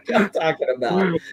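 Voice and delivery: ordinary talk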